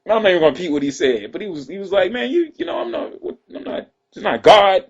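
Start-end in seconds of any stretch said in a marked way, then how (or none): 2.1-4.9 much higher, mocking disbelief; high squeaky scoff. Imitating his friend mocking the Jordan worshippers, squeaky and high. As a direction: squeak it out like he can't believe them.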